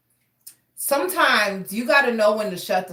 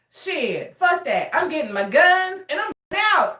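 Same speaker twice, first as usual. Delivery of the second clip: put-on defiant good old boy; 7 semitones higher than usual. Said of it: "He was like".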